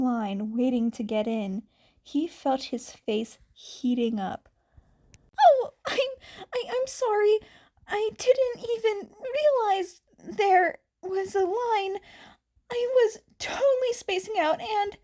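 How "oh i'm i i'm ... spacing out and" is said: high, breaking, tearful stammer; flustered apology. Acted. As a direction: blurt it out high and shaken, near tears.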